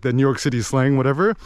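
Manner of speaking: ordinary delivery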